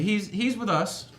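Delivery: high, loud, drawn-out appeal; cheesing reassurance. Acted. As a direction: vouch for a friend through a big grin. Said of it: "cheesing at him".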